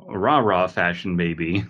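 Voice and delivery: ordinary delivery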